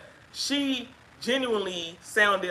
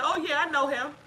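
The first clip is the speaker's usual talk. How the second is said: casual quote lifted much higher; breezy voice for the other woman, pitched up; imitating the other woman; 9 semitones higher than usual. Wife retelling the caller's casual reply, higher voice. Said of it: "and she said".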